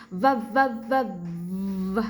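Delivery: voiced v buzzing; held buzzing v. Shown as a demonstration. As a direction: buzz the v against the lip. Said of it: "Like that".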